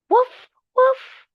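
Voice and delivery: high, loud playful woofs; dog bark. Pretend. Teacher quizzing a child on animals, dog bark. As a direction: bark twice like a little dog, high and short. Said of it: "What animal is that?".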